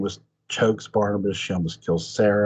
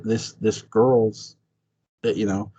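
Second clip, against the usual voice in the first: ordinary delivery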